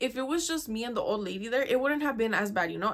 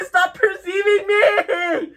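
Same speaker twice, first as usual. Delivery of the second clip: higher, louder, very slow cry of "perceiving"; mock desperate shout; 9 semitones higher, 12 dB louder, much slower than usual. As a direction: wail "stop perceiving me" at full volume. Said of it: "just be like".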